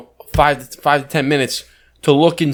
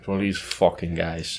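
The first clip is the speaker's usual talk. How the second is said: low, soft grumble; muttered aside; 8 semitones lower, 9 dB quieter than usual. Acted. Co-host voicing a customer's thought about the clerk, muttered aside. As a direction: grumble it privately, low in pitch.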